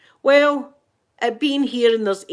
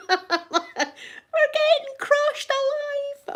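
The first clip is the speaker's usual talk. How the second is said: laughing; much slower than usual. Retold or imitated. Laughing retelling of her panic.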